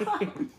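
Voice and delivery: soft breathy laugh. Real, not acted. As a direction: let a laugh slip out.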